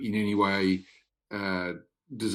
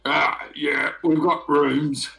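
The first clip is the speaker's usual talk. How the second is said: higher, louder casual bouncer voice; half orc doorman; 5 semitones higher, 5 dB louder than usual. Acted. Orc doorman answers the noble guest, loud and casual. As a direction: brush them off, blokey and loud.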